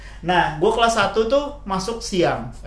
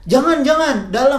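higher-pitched urgent caution; retold warning; 7 semitones higher than usual. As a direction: imitate a guard warning someone off. Guard warning stubborn rider, retold. (memperingatkan dengan nada tinggi)

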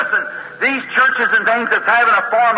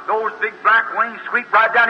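ordinary talk, nothing marked

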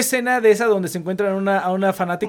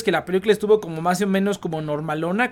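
usual speaking voice

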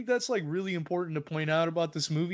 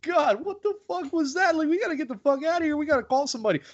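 mock panic, higher pitch, rushing on the last lines; hammed-up fright, voice pitched up; panicked; 10 semitones higher, faster than usual. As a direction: act freaked out and desperate to flee. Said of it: "I would've been like".

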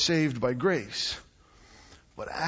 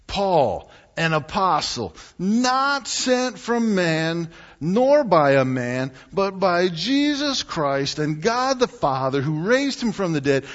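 forceful reading; 4 semitones higher, 6 dB louder than usual. Acted. Reading Paul's forceful opening.

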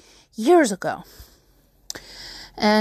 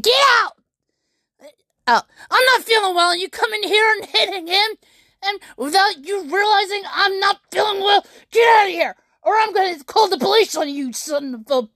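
high shouted rage with tears in the voice; imagined angry yell; 6 semitones higher than usual. Retold or imitated. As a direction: throw him out, furious and tearful. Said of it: "and said".

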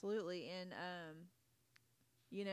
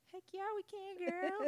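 high, eager yell of yes; excited; 13 semitones higher, 4 dB louder than usual. Retold or imitated. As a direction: cheer it out, way up high. Excited reply to daughter's request.

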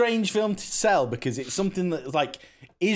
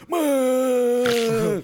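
"meh" pitched higher and louder; a punchier, higher "meh"; better version; 6 semitones higher, 4 dB louder than usual. Shown as a demonstration. Showing friends the better way to say it, higher. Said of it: "But it's better if you go".

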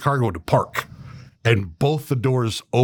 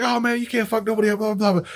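trash talk; 10 semitones higher, faster than usual. Retold or imitated. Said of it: "Kevin Hart's just talking shit".

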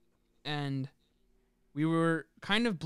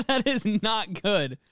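laughing; 5 semitones higher, 6 dB louder, slower than usual. Real, not acted. Laughing reaction to the story.

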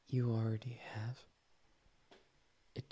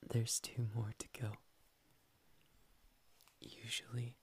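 ordinary talk

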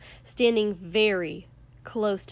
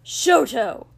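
sharp, gravelly shout, higher and loud; furious snap; 4 semitones higher, 8 dB louder than usual. A narrator's reading. Angry man calling from the front of the hut, furious. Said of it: "a sharp, gravelly voice snapped".

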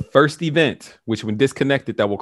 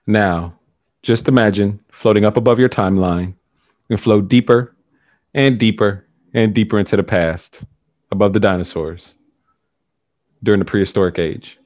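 guided meditation; 3 semitones lower, 4 dB louder than usual. Acted. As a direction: lead them gently down the timeline. Repeats float instruction.

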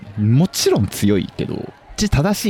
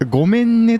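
sweet-voiced apology; 11 semitones higher than usual. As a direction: croon it like a love ballad. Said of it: "と言ってるアーティスト".

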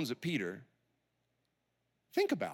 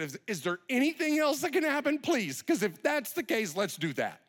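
higher-pitched, urgent appeal; pleading; 6 semitones higher than usual. Acted. Jesus asking for any other way, pleading. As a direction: ask as a last hope, pitch raised.

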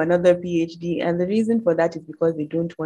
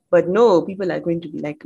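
usual speaking voice

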